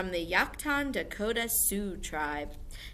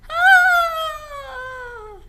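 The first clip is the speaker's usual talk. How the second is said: very high, loud scream; creepy distant scream; 14 dB louder than usual. Shown as a demonstration. Teacher shows the class a spooky scream. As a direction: scream like someone lost in a dark forest. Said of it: "Can you do it?".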